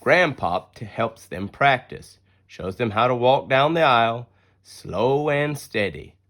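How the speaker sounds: plain reading voice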